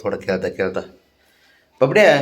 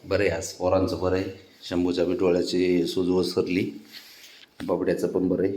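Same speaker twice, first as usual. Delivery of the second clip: quiet aside; 5 semitones lower, 9 dB quieter than usual. Real, not acted. Quiet update to camera.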